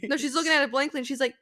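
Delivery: ordinary delivery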